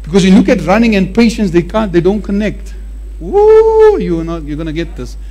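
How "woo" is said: high whooping woo; excited whoop. Real, not acted. Preacher cheering the runners on, excited whoop. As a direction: let out a joyful woo.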